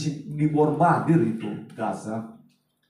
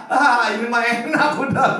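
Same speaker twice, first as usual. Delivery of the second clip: relieved laugh; 7 semitones higher, 5 dB louder than usual. Retold or imitated. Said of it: "saya bilang ya".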